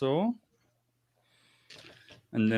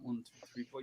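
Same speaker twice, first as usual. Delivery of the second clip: very soft, barely voiced count; counting under breath; 18 dB quieter, faster than usual. Game master acts out a quick count, under his breath. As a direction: count off quietly as if to yourself.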